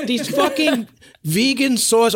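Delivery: imitating an outraged right-wing Brit at the vegan sausage rolls; mock angry rant. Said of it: "And he was like".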